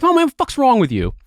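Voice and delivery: high-pitched exasperated challenge; retold confrontation. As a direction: confront a lane-blocker in disbelief.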